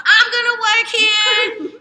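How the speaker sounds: high, slow, full-volume shout; hammed-up giddy yell; excited announcement. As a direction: proclaim it to everyone in earshot, drawing it out. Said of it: "You so excited?".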